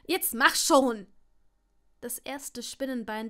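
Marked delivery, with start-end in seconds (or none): 0.1-1.1 loud, drawn-out urging; impatient shout. A narrator's reading. Draco pressing Longbottom to cast, shouting impatiently. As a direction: hurry him along loudly, stretching the words. Draco urges on as spider nears.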